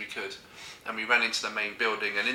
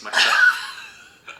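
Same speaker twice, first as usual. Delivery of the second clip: laughing; 7 dB louder than usual. Real, not acted. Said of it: "laughing telling embarrassing story".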